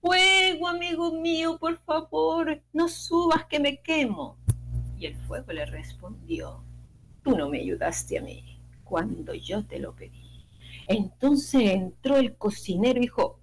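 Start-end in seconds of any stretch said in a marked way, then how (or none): 0.0-4.4 frightened cry. Acted. Chick begging the rising fire not to burn him, high frightened cry. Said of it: "gritó". 7.2-10.5 cold fire voice. Acted. Fire answers coldly.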